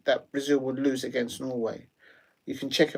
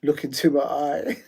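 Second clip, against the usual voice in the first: ordinary delivery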